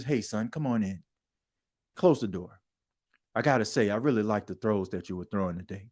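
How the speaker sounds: low, soft coach talking to his player. Pretend. Coach welcoming his player into the office, low and soft.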